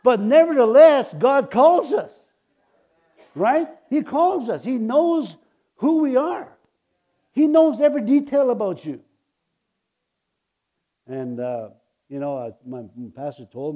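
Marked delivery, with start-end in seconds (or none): 0.0-9.0 loud, high, rising sermon cry; full-force preaching. Acted. Preacher exhorting the congregation in a loud, high voice. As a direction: preach it out to the whole room.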